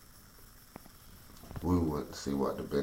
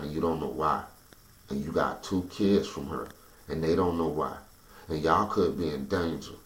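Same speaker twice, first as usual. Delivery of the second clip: rising, louder appeal that slows on the last demand; heated plea; 3 semitones higher, 5 dB louder than usual. Retold or imitated. To the security guard, begging him to understand, heated and loud. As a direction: force the guard to imagine himself in your place.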